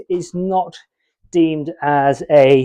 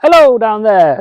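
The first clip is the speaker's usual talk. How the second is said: raised voice calling down to someone below; 5 semitones higher, 10 dB louder than usual. Real, not acted. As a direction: call down loudly from above.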